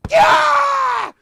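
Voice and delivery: very high, loud "Kia!"; kia shout. Shown as a demonstration. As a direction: scream "Kia!" all out. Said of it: "Kia meaner and louder".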